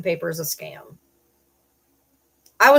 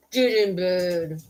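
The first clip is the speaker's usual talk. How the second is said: slow stern command; much slower than usual. Real, not acted. Ordering dogs, slowly.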